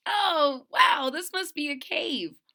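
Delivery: high, loud wonder; bright-eyed discovery of a cave; excited little bear. Acted. Retelling Corduroy finding the cave, high and amazed. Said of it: "he says".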